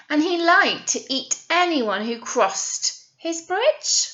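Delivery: lively storybook reading voice; animated picture-book narrator; story narration. Acted. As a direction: read it out to children with energy.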